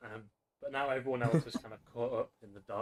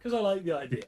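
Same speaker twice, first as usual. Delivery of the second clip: eager, raised voice, keen on the idea; excited; 9 semitones higher, 10 dB louder than usual. Real, not acted. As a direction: sell the idea with enthusiasm. DM justifying with enthusiasm.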